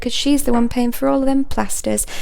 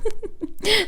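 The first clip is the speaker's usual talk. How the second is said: scornful laugh; 11 semitones higher, 4 dB quieter than usual. Acted. Laughs at own insult.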